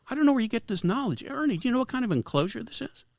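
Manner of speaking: high, fast, needling questions; talking down to a know-it-all, high and fast; mocking interrogation. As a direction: grill him with mock disbelief. Retold mocking grilling of Ernie.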